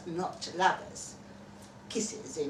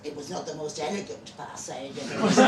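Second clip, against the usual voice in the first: usual speaking voice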